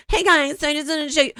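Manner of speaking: high, fast, chirpy vlogger greeting; mock the bubbly YouTube intro; hammed-up YouTuber opening. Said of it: "just like, "Hey guys".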